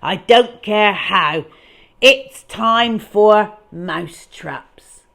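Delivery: loud, slow, heavy boss order; bellowing. Acted. Boss demanding mouse traps, loud and slow. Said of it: "Mister Fame was bellowing".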